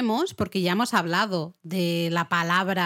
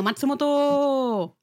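'Matsumoto' drawn out slow and higher, like a platform call; station announcer voice; 5 semitones higher, much slower than usual. Retold or imitated. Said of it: "vamos a hablar de Matsumoto".

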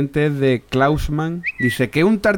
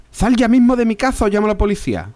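high voiced partner; 10 semitones higher, 3 dB louder, faster than usual. Partner's answer in joke.

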